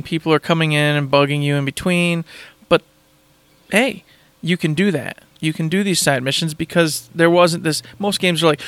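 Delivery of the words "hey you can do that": ordinary talk, nothing marked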